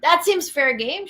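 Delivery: incredulous mocking echo. Vlogger mockingly echoing the CNN anchor, incredulous. Echoes anchor's words in disbelief.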